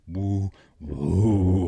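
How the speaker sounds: loud, drawn-out "woo" as a bear's growl. Acted. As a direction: imitate an old bear growling. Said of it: "is growls and goes".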